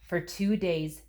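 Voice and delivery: ordinary delivery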